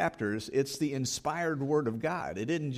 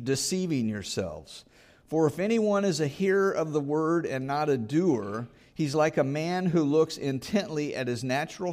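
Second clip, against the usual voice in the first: preaching at full force; 3 semitones higher, 3 dB louder than usual. Acted. Preacher urging the congregation to act, at full force. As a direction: press the congregation hard, rising with conviction.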